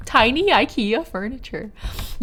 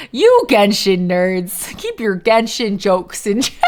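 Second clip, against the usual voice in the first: playful, louder telling-off; drawn-out opening, loud and teasing; loud mock scolding; 5 dB louder, slower than usual. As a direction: scold them loudly in jest. Same mock scolding, repeated louder.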